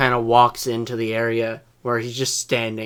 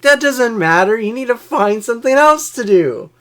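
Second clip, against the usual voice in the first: higher, louder bossy command; mock stern order; 10 semitones higher, 7 dB louder than usual. Manager ordering the coworker to find other work, loud mock order. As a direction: boss him off the sink.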